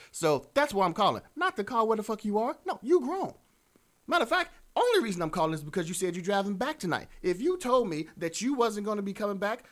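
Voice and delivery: high-pitched, mildly indignant acting; exasperated caller. Caller explaining to a partner why they rang, exasperated.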